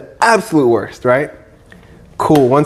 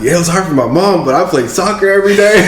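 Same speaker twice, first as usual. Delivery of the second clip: quick, higher and laughing; laughing retold reply; 7 semitones higher, faster than usual. Guest retelling his carefree childhood answer, laughing and quick. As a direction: tell it brightly, laughing at the irony. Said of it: "I'm like".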